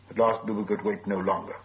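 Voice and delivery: plain reading voice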